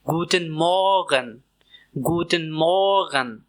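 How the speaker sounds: गुडन मॉर्गन slowly with t sound; drill Guten Morgen. Shown as a demonstration. Teacher drilling गुडन मॉर्गन for learners. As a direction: model गुडन मॉर्गन clearly. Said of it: "Repeat after me".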